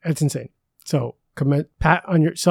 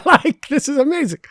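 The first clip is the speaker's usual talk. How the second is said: high-pitched amused laugh-talk; 12 semitones higher, 7 dB louder than usual. Real, not acted. Host joking about awkwardly receiving thanks, laughing. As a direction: let a laugh lift the words.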